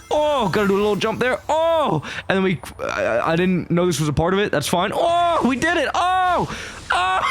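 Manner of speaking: high, rising excited yelps. Real, not acted. Player landing the platforming, high thrilled yelps and a laugh. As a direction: yelp with delight at each jump. Excited while pulling off jumps.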